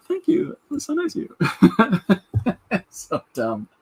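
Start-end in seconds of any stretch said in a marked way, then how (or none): 0.1-1.4 high mock-flattered voice. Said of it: "I'm like". 1.4-2.8 laughing. Real, not acted. Laughing at own story.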